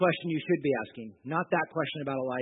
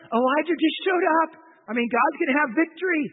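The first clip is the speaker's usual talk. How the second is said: much higher and louder, thrilled; excited disciples; 11 semitones higher, 6 dB louder than usual. Retold or imitated. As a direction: voice the disciples' eager hope. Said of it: "they're probably thinking".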